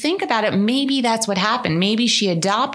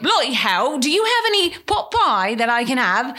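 hammed-up rural English accent; countryside English accent; 4 semitones higher than usual. Honeymooner ordering pie in the countryside, hammed-up English accent. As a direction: lay on a fake countryside English accent, pitched up. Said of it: "I really bought it".

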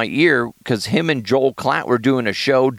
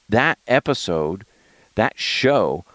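ordinary delivery

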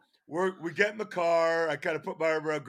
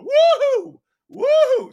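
high, loud imitated cheer from the sideline; cheering woo-hoo; 12 dB louder, slower than usual. Mom on the far sideline cheering her team, high loud woo-hoo. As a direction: mimic the opposing mom's shrill cheering. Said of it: "she just keeps going".

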